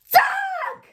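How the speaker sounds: very high, loud sudden yell; shouted warning. A narrator's reading. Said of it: "you shout".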